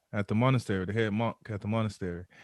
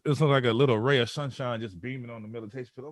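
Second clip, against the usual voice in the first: normal talking voice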